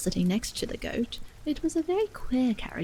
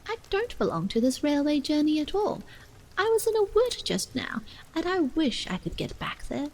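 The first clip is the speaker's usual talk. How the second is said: put-on higher girlish protest; a child insisting she's lost; Alice, high and earnest; 6 semitones higher than usual.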